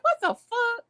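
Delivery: high, stunned exclamation; shocked. Retold or imitated. Reacting to the girl going clubbing, high shocked. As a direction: shriek it in disbelief. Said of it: "I'm like".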